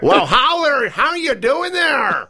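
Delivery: high, slow American accent attempt drifting Irish; wobbly mock American accent that slips into Irish. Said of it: "That's a very good Irish".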